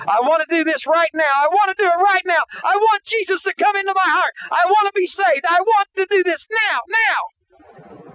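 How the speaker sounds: high, loud pleading yell; desperate shout. Retold or imitated. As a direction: cry out with everything, high and loud. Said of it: "screaming at the top of his lungs".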